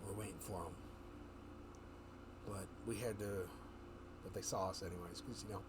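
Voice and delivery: low, hushed ambush voice; whispering as if the giants could hear. Retold or imitated. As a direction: whisper it, like hiding on the rock.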